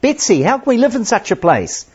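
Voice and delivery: high, pleading cry to her sister. A narrator's reading. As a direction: cry out in dismay, pitched high. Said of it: "she cries out".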